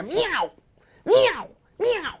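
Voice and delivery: short high sharp meows; angry cat meows. Acted. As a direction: spit out three angry meows.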